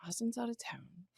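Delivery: whispered plotting aside; secret whisper. Retold or imitated. Working out the night out, whispering conspiratorially. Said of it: "I'm like".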